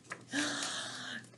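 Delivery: a sudden unvoiced gasp before the wail; sharp gasp. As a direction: catch your breath like a child about to scream. Bear's tantrum acted out.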